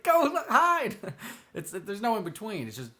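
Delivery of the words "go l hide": high frantic yell; panicked shout. Retold or imitated. Acting out panicked people.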